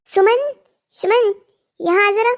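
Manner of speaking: mother-in-law's high, demanding call. Acted. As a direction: call sharply for someone in another room. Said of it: "फिर से शुरू हो गया तेरी सास का चिल्लाना".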